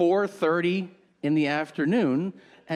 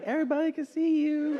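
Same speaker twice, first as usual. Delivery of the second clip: super polite hostess; 10 semitones higher, faster than usual. Retold or imitated. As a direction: break awkward news ever so politely, way up high. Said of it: "super polite".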